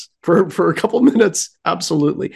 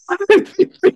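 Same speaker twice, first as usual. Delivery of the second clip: laughing; 3 dB louder than usual. Real, not acted. Laughing at bent keys.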